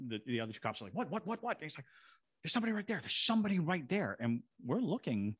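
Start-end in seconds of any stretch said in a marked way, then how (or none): 0.9-1.6 high, rapid-fire mock alarm; alarmed cops. Two cops reacting to a drawn gun, imitated alarm. 2.4-4.2 high, urgent retold warning; imitated alarm. Cop warning others of someone in the shadow, imitated alarm.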